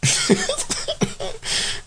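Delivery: airless, high wheeze of a laugh; breathy giggle. Real, not acted. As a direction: giggle at your own joke. Laughs at own bit.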